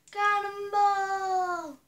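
long drawn-out high yell; cannonball yell. Pretend. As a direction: belt it out as you jump. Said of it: "Should I do it?".

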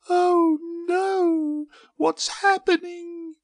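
very high, loud wail of a tiny seed; hammed-up high-pitched distress; alarmed cry. A narrator's reading. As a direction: cry out in panic, squeakily. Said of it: "she cried".

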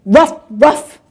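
loud, gruff-spelt dog barks; barking dog. Acted. Big dog barks at the kittens, loud barking. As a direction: bark out two sharp bursts like a dog. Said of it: "and the dog goes".

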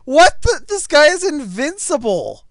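high, loud disbelief; incredulous outburst. Real, not acted. Player facing an invincible opponent, incredulous shouting. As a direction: exclaim it high and loud, baffled.

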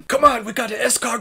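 put-on over-the-top French accent panic shout; mock French panic. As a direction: scream it like a panicked French soldier in a chase. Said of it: "be like".

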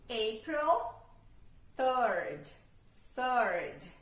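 "third" with the tongue at the teeth and some air; th sound. Shown as a demonstration. Teacher shows students the tongue-on-teeth th. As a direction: blow a little air on the "th" of "third". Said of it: "T com a língua na ponta do dente".

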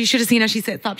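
normal talking voice

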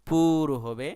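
পুর হবে, loud teacher's emphasis; emphatic. Shown as a demonstration. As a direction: drive পুর হবে home to the class. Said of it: "আমি আবারও বলতেছি".

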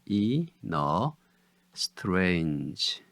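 "이", "너", then a drawn-out "strange"; syllable by syllable. Shown as a demonstration. Teacher gives syllables "이, 너, strange", one by one. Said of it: "음절은 이".

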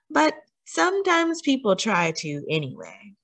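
put-on gentle picture-book read-aloud with questions to the child; a touch of storyteller warmth, mostly natural voice; reading to children. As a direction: read it like bedtime story time for a small listener.

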